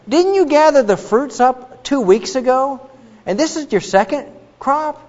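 high-pitched, pointed mock questioning; questioning God. God questioning Cain about his crop, pointedly probing.

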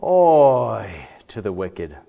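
slow guttural lament; bemoaning oi. Acted. Preacher voicing God's grief over the wicked, slow lament. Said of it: "This is".

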